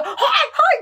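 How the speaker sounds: high, laughing shout of hoy; hey hey, yelled in laughter; mock yell. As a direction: call out like squabbling aunts at cards. Aunts fighting over cards.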